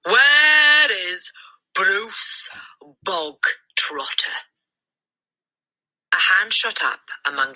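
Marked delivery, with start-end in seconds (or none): 0.0-4.4 loud start, drawn-out slow name; bossy headmistress. A narrator's reading. The headmistress demands the boy in front of the school. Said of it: "the Trunchbull barked".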